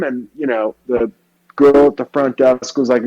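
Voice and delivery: ordinary delivery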